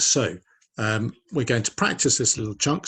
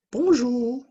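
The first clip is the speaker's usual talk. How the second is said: very high, loud puppet voice; high-pitched puppet character; Inès puppet voice; 6 dB louder than usual. Acted. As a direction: squeak out a greeting as a little puppet. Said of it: "Matisse and Inez, they've decided to dress up differently".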